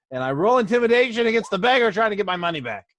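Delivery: high, loud, fast rush of indignation; irritated outburst. Acted. Irritated player intimidating the beggar.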